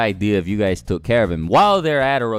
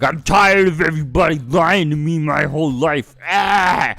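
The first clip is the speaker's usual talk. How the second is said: imitated shout, raised high and loud; retold screaming; 6 semitones higher, 6 dB louder than usual. Sarada screaming at Naruto over lies, imitated shout. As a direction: yell it like an upset kid, hammed up. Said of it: "starts screaming at Naruto".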